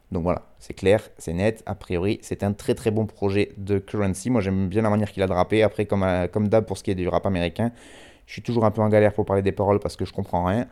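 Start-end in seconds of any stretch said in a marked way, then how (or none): none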